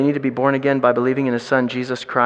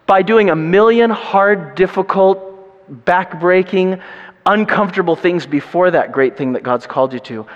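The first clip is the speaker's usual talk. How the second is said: ordinary talk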